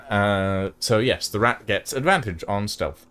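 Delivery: normal talking voice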